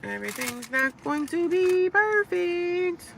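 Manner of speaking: high, slow, drawn-out preachy lilt; lilting high slow sing-song. Acted. Vlogger repeating her motto to viewers in a slow high lilt. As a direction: stretch it out sweetly like a mantra.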